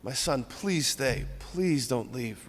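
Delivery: soft, slow pleading; begging father. Retold or imitated. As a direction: plead quietly with a son who is leaving. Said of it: "imagine him begging, pleading".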